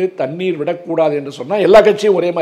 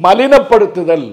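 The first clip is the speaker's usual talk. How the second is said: slow and louder, each syllable of மலினப்படுத்துதல் leaned on; emphatic word; 4 semitones higher, 7 dB louder, slower than usual. Real, not acted. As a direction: drag out மலினப்படுத்துதல் slowly and loudly, like an accusation. Speaker denounces belittling tactics.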